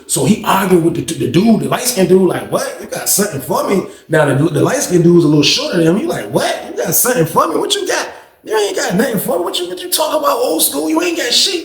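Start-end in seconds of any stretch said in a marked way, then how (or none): 2.5-3.9 lightly voiced quarrelling dude, high and soft; mock challenge. Light-skinned dude answering Luke back, mock challenge. 6.3-11.6 high-pitched, soft mimicry of a defiant dude; mock taunting. Shorter light-skinned dude talking back to Luke, mock taunting.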